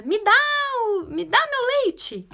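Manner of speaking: high, loud, bossy child imitation; mock yell of a demanding toddler; child's shouted order. Child ordering a parent to bring milk, shouting. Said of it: "gritou dizendo assim".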